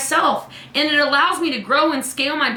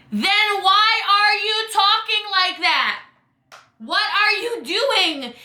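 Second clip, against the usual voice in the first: slow, high, drawn-out exasperation; exasperated scolding; 6 semitones higher, much slower than usual. Acted. Coach scolding people who send cringey messages, exasperated. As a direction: stretch each word in disbelief.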